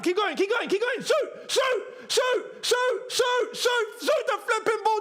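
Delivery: screaming at the screen for a shot; excited shouting. Real, not acted. Urging his team's attack to shoot.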